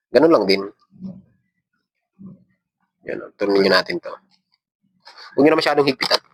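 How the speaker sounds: usual speaking voice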